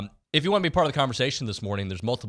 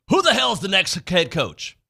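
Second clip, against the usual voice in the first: loud, high, confrontational demand; mean tone; 9 semitones higher, 7 dB louder than usual. Acted. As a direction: read it like a mean internet troll, loud and drawn out. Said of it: "I prefer to read it in a mean tone".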